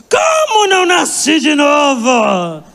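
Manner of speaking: outraged cry. Retold or imitated. Daughter crying out at her mother's rebuke, outraged and tearful. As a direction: cry out in hurt disbelief. Mother says not born again.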